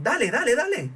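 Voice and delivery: high excited urging, speeding someone up. Retold or imitated. Urging a drummer to speed up, excited high calls. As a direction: pitch rising sharply on each dale. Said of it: "de repente te dicen".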